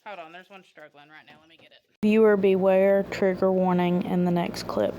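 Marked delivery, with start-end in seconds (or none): 2.0-4.9 loud warning. Real, not acted.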